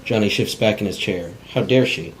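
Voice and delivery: ordinary talk, nothing marked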